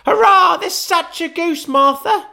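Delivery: put-on very high, loud gleeful children; excited children. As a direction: kids cheering about the goose. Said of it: "cried the two young Cratchits".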